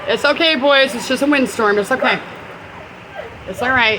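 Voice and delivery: high, loud soothing pet talk; reassuring. Real, not acted. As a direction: comfort frightened animals over the wind. Comforting animals during the windstorm.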